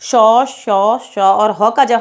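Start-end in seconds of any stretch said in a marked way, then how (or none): 0.0-0.6 श drawn out with a strong hiss of warm air; teacher modelling a breathy श; hissed demo of श. Shown as a demonstration. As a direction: push the breath through श, श. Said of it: "इन वर्णों का हम उच्चारण करते हैं".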